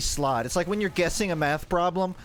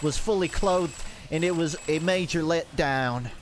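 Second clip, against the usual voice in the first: put-on Southern accent. Streamer spinning a strip mall yarn in a Southern accent. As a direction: talk like some kind of Southerner. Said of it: "what kind of Southerner talks like this".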